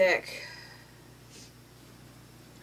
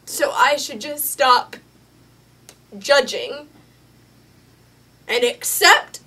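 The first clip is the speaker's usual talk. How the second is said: louder, hurling the question back at him; angry retort; 5 semitones higher, 8 dB louder than usual. Acted. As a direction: push back hard, challenging every word. Jesse argues back at the leader.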